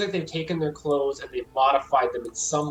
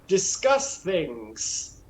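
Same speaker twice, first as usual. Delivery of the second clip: higher voice slowing to a halting end; awkward fake cultist; 5 semitones higher than usual. Doctor inviting visitors to the tent, stilted and conspiratorial. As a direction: invite them in with stilted, knowing pauses.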